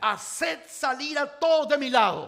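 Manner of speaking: slow, loud cry of command; preacher booming the order; exclaimed command. Acted. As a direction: call it out so the whole hall hears. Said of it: "Y exclamó".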